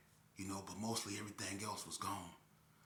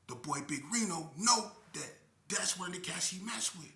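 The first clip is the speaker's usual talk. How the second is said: ordinary talk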